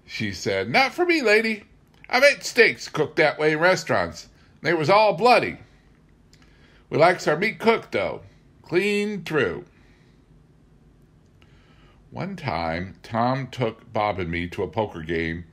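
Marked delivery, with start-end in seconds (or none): 0.7-9.7 much higher, louder, folksy country woman; Mrs Kettle's voice. Retold or imitated. Mrs Kettle telling the narrator she wants meat cooked, high and folksy. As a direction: set the city lady straight.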